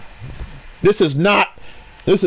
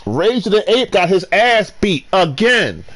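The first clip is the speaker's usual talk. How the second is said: ordinary delivery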